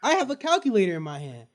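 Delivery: ordinary talk, nothing marked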